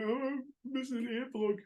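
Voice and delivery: high, put-on voice for a mocking quote; mock whiny quote. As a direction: lightly mimic a flustered bandmate. Mimicking the other's lost earplug.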